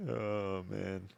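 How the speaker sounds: usual speaking voice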